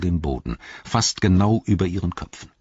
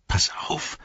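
urgent half-whisper; whispered warning; 9 semitones higher, 3 dB quieter than usual. A narrator's reading. Frodo warning Sam behind him, whispering. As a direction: whisper a caution to your companion. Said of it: "flüsterte Frodo".

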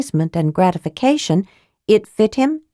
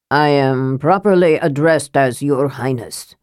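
intoning Sturm; 4 semitones lower than usual. A narrator's reading. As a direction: pronounce it like a haughty king. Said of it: "Sturm intoned".

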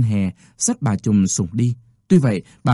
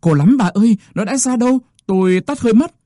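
high, strained cry of a woman in labour; pained moaning cry; 10 semitones higher than usual. A narrator's reading. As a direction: wail it through the pain, pitched high. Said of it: "chị quằn quại kêu".

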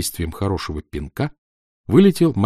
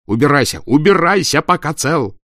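loud, higher furious shout; 7 semitones higher, 6 dB louder than usual. A narrator's reading. Actor furious at the makeup boy, yelling him away. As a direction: drive him off with a yell.

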